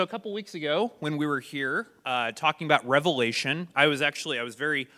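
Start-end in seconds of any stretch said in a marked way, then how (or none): none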